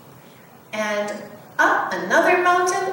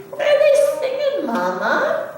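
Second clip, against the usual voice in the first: put-on high, fast child question; a curious little child; eager little cub; 14 semitones higher than usual. Little cub asking Mama about the singing.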